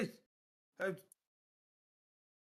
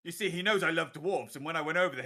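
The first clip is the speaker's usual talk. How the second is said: ordinary talk, nothing marked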